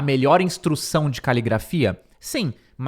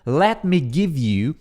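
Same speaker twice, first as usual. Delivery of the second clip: slow, careful English phrase; slow model reading; much slower than usual. Shown as a demonstration. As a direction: spell it out slowly for the class. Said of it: "Você vê que em inglês é". (leitura lenta e pausada)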